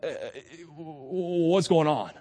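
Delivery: mildly accusing, drawn out; accusatory. Acted. Priests questioning one of their own, mildly accusatory. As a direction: challenge him with mild suspicion. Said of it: "they're coming at him accusatorily".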